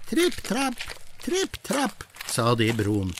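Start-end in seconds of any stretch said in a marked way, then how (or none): 0.1-2.0 high, soft tiny hoofsteps; light tripping steps. Acted. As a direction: tiptoe it high and softly. Smallest goat crossing bridge.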